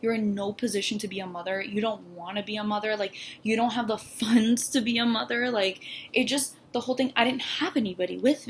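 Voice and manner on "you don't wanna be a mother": ordinary talk, nothing marked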